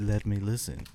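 imitating a smooth sexy voice; low and smooth, lightly mocking; mock seductive. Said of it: "Yeah, he said".

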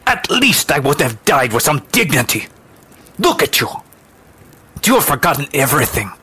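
high, heated accusation; bitter accusation. Acted. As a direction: throw the blame back passionately. Quarrel between the two women.

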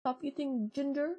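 high, sing-song mock voice of her boyfriend; ex-boyfriend showing her a phone, in a high mocking voice. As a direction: mimic him eagerly pushing the phone at her. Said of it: "he just showed me the phone. Like this".

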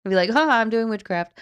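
laughing through the words; giggling, amused. Real, not acted. Laughing about lazy witchcraft.

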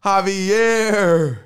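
high, drawn-out name call; announcer call-out. Pretend. Host calls out crew names.

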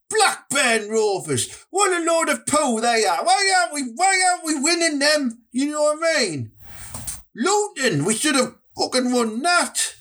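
high-pitched exasperated rant, with a shout. Acted. Podcast host ranting about his football club's losses, high and exasperated. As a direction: vent your fury at the team, rising to a yell. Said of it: "that was me rant over there".

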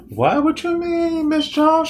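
very high, playful fake-innocent squeal; coy mock innocence. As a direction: play dumb in a high voice. Feigning innocence to director.

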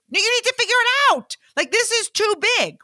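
high-pitched excited urging; excited insistence. Retold or imitated. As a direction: squeal it like the concert is slipping away. Excited friend insisting on concert.

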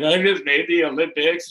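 mildly mock-tearful announcement; imitated tearfulness. Crying player tells store lady the news, mildly tearful. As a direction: blurt it with a hint of crying. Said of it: "I'm still crying".

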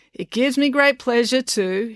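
high, slow, stilted speech reading. A narrator's reading. Mister Lang reading his notes, slow speech voice. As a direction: read it out carefully to a crowd. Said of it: "read Mister Lang".

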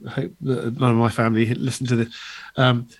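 ordinary talk, nothing marked

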